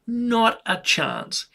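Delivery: NOT a chance, slow and drawn out; emphatic repeat. Real, not acted. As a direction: stretch each word of "Not a chance" slowly. Repeats answer slowly for emphasis.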